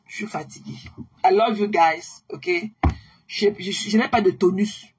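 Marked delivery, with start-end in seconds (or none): 0.1-0.8 tired. Real, not acted. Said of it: "Vous voyez, non, mes gens".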